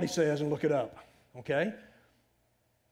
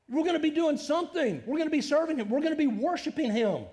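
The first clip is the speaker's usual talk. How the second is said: higher, louder, rising fervor; fervent preaching; 11 semitones higher, 6 dB louder than usual. Real, not acted. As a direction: preach it with passion. Preacher builds to a climax.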